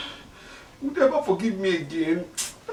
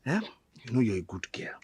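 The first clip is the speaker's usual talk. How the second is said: coaxing whisper, lower; 5 semitones lower, 10 dB quieter than usual. Acted. Father whispering to coax his daughter. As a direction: whisper to win her over.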